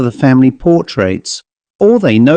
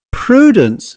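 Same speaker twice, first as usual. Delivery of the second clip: high-pitched stern reproof; 10 semitones higher than usual. A narrator's reading. Elder sister rebuking Prudence, stern. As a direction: call the name in reproach. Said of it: "said her sister with a stern accent".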